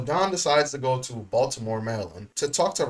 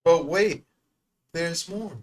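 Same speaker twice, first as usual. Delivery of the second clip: infomercial announcer; 5 semitones higher, 3 dB louder, slower than usual. Pretend. Infomercial catchphrase between clips.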